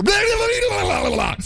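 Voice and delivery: high far-off shout of nonsense; mock shout heard two fairways over; distant golf yell. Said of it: "you hear something yell".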